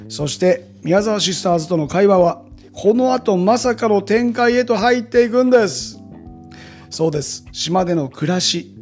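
upbeat teaser narration; punchy variety show voiceover. Acted. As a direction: sell the next episode. (バラエティ番組風のナレーション)

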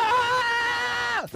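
high, joyful shout; excited yell. Retold or imitated. [name] spotting his friend at the movie set, a high yell of joy. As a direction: let out a high yell of delight.

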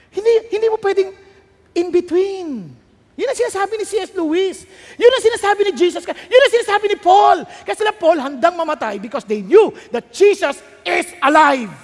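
loud, high, emphatic sermon delivery; preaching at full force. Real, not acted. (malakas at madiing pangangaral)

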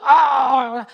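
loud imitated storm waves; loud wave roar. Director setting the stormy scene, loud wave roar. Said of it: "the waves is still like".

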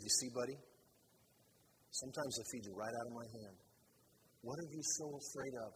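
a tender inner word, softly; gentle inner voice. Retold or imitated. The Lord speaking softly into his heart. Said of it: "whispered deep into my heart".

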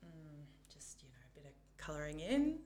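put-on whispered, sheepish child; whispering child. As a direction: mumble it like a shy kid. Child self voiced in story.

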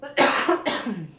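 sudden choking cough; coughing fit. Real, not acted. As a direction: cough hard, clearing the throat. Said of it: "I was choking a little bit".

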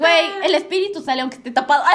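loud, high, laughing objection; laughing protest. Real, not acted. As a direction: crack up while protesting. Laughing protest about the well.

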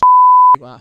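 a sudden loud high cackle of laughter; loud burst of laughter. Real, not acted. Laughing loudly at the end of his own drug story. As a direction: let a big laugh burst out at the punchline.

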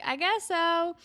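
high, put-on reluctant reply; a hammed-up half-hearted agreement; lightly voiced awkward boy; mock shrug voice.

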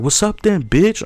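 high mock challenge; retold yell. Telling his friend how the homeless lady squared up, high mock yell. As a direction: raise the pitch like she's squaring up. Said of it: "she was yelling".

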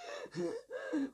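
high choking gasp, imitated. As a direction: gasp like a granny fighting for breath. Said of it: "It's a very spot-on impression".

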